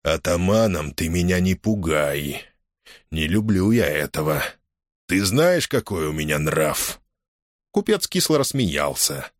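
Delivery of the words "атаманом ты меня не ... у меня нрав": тихо, растягивая каждое слово; говори медленно, тянув слова; негромко и растянуто. A narrator's reading. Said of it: "он негромко, сильно растягивая каждое слово". (негромкая растяжка слов)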